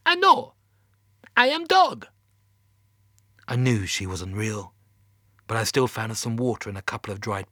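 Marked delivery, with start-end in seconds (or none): 0.1-2.1 loud, very high Russian dog voice; hammed-up bad Russian, pitched way up; terrible Russian accent. A narrator's reading. Laika the space dog to a fellow explorer, high terrible Russian accent. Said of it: "That's a terrible Russian accent".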